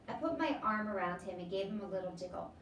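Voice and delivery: ordinary delivery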